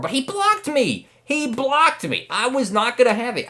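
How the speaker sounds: high, insistent complaint; indignant protest. Real, not acted. Racer justifying the wreck, high indignant protest. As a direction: insist it was his fault. Defending himself after race.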